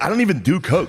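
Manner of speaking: high-pitched mock yell of a sweaty promoter; imitated scream. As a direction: yell it like a wired promoter after a set.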